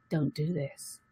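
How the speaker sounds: very soft, low inner warning; still small voice. Acted. Intuition answers the pleading lover, still small voice. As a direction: drop right down to a hushed, low caution. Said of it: "the still small voice".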